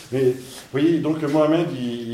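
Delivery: normal talking voice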